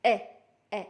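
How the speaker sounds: ए ए with mouth open, short; ए ए, clear open vowel; short e sound. Shown as a demonstration. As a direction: stress the open ए twice. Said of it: "इस तरीके से इसका sound होता है".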